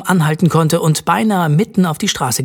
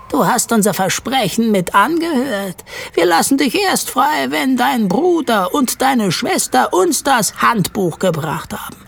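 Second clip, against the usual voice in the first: hohe, fremde Elfenstimme, kalt und bestimmt; strenger Elf; 8 semitones higher than usual. Acted. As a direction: wie ein unnahbares Waldwesen fordern. Blatthornelf voice. (Elf stellt Jared seine Bedingung)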